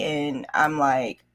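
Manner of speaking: ordinary talk, nothing marked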